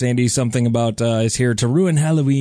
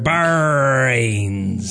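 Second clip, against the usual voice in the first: zombie moan. Pretend. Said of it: "it would be like".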